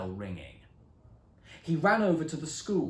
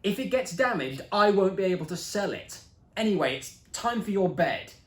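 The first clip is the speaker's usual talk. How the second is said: loud, raised no and telling-off; firm scolding; 6 semitones higher, 8 dB louder than usual. A narrator's reading. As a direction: shut him down, bossy big sister. Sister scolds brother.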